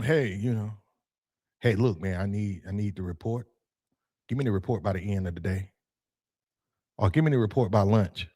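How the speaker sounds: lightly voiced boss leaning on staff; micromanaging boss. Manager quietly pressing staff for the report. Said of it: "and you tell them".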